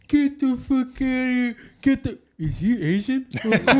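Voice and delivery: slow, high, loud garbled voice; borrowed imitation of her muffled holler; mock deaf speech, hammed up for laughs; deaf mother-in-law. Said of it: "do her voice".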